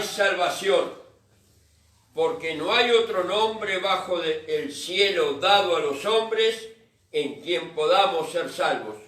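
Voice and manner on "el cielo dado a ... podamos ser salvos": usual speaking voice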